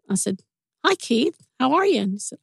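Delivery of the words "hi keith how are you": polite phone greeting. Retold or imitated. Retold answering a stranger's call.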